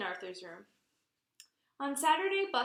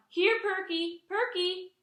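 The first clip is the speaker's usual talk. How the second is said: high loud calling for the dog; 9 semitones higher, 7 dB louder, slower than usual. Acted. Arthur calling for missing Perky, high and loud. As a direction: call the lost dog across the house. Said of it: "called Arthur".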